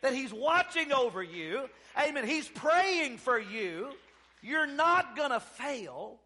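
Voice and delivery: rousing revival exhortation; full-force preaching. Real, not acted. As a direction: preach it hard, stretching key words.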